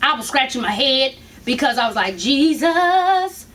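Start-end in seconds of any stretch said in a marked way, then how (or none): 2.2-3.5 praying for patience. Retold or imitated. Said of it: "That's what I was feeling".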